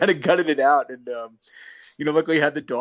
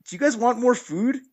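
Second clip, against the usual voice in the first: imitated appalled question; 10 semitones higher than usual. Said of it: "looked at us appalled".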